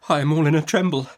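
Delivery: mild trembling falter; a touch of shaky fear. Acted. Frightened young mother to stern aunt, faltering. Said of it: "faltered my mother".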